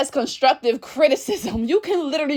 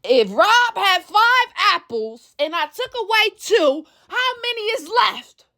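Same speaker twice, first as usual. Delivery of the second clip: put-on slow, careful explaining, like to a child; patient explaining; 6 semitones higher, slower than usual. Retelling the argument, slowly and patiently explaining. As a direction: spell out the sum patiently. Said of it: "your tone might change now".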